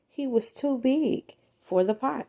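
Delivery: usual speaking voice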